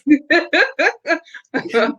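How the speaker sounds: high delighted burst of laughter; laughing hard. Real, not acted. Host laughing at the comment, bursting into high laughter. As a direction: crack up at the comment.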